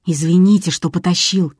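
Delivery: ordinary delivery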